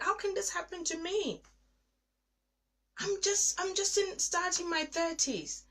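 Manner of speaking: high, loud, pleading cry; anguished lament. Retold or imitated. Alone in the bathroom, crying out to God in anguish. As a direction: pour out hurt and blame at God. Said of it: "And I'm like".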